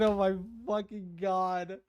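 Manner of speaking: normal talking voice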